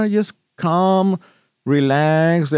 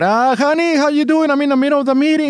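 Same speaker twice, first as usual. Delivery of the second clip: much higher, fast gushing voice; gushing wife; 8 semitones higher, faster than usual. Retold or imitated. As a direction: gush over the phone like a thrilled wife. Excited wife voiced mocking.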